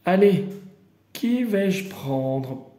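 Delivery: usual speaking voice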